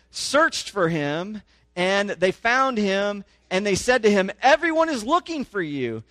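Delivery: rising, emphatic public reading of the passage; scripture reading. Acted. Pastor reading Mark to his congregation, animated scripture reading. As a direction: read the gospel out to the congregation with growing force.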